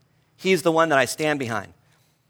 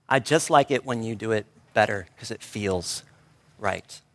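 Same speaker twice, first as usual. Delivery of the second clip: lower, soft and tender; tender child quote; 5 semitones lower, 4 dB quieter than usual. Retold or imitated. Preacher repeating his son's words to the congregation, softly tender. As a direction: gently echo the child's wisdom. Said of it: "Through the mouth of a five-year-old".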